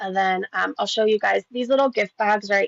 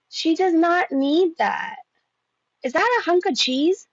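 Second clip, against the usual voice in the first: appalled; 9 semitones higher than usual. Real, not acted. Appalled reacting to the meal.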